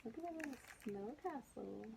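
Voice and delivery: ordinary delivery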